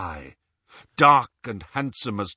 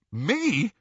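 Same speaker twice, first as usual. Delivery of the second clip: high-pitched startled question; shocked; 8 semitones higher than usual. A narrator's reading. Ron asked by Harry to wield the sword, shocked. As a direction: blurt it out in disbelief. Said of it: "looking shocked".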